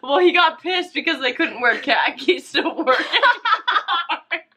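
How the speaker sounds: laughing. Real, not acted. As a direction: giggle while you explain. Amused describing the crush.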